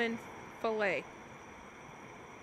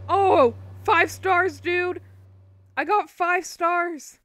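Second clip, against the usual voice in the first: loud, much higher cheer of delight; excited yell; 6 semitones higher, 11 dB louder, much slower than usual. Real, not acted. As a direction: shout the win out loud, stretching it. Gets five stars in game.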